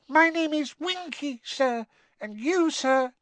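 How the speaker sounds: put-on teeny, high-pitched elf voice; squeaky house elf. A narrator's reading. Winky introduces herself to Harry, squeaky elf. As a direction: introduce yourself in a tiny squeak. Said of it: "a teeny quivering squeak of a voice".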